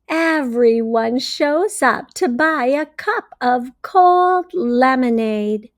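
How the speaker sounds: ordinary delivery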